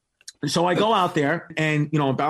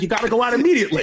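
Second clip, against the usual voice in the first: rushed, raised-pitch instruction; urgent warning; 6 semitones higher, much faster than usual. Retold or imitated. Producer warning a comic before his entrance, urgent. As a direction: rattle it off urgently.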